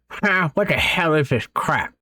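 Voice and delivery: put-on high, slow, sneering old man; scornful old man. A narrator's reading. Grandfather throws the meal at her, high sneering voice. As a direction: spit it out in disgust.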